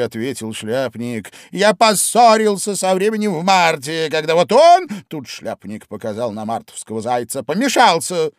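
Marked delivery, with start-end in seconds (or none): 1.5-8.3 high, loud, lively Hatter; the Hatter spinning his tale with gusto. A narrator's reading. Hatter tells his story.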